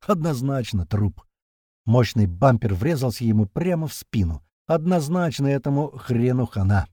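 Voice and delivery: usual speaking voice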